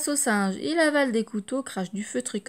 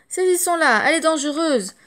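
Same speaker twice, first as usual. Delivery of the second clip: urgent shout; 6 semitones higher, 6 dB louder than usual. Acted. Circus men rushing to capture.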